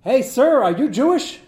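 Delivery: high, loud call out a car window; yelled from car. Retold or imitated. Men in a car yelling at a man outside shul, loud call. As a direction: shout it across the street. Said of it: "they yelled out the window".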